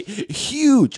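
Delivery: high, loud mock-stoner amazement; hammed-up stoner exclamation; awestruck stoner. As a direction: imitate a stoner blown away, voice way up. Stoner marvels at the urinal find.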